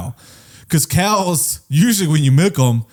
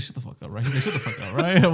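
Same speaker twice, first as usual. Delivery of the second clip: low, much softer acted command; hushed cop order; 3 semitones lower, 7 dB quieter than usual. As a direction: shut the driver up under your breath. Cop silencing the detained driver.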